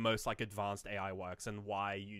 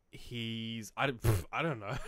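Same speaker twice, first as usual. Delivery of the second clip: usual speaking voice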